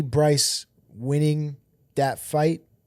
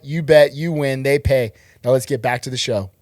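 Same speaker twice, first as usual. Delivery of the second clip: upbeat ad read, punchy and louder; sponsor ad read; 4 dB louder than usual. Acted. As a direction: sell it like a radio spot. Ad slogan and sign-off.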